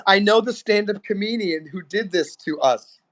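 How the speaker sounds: lightly put-on Indian accent; a hint of Indian accent, teasing; mock Indian accent. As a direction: Indian accent character naming the comedian. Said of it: "[name] says".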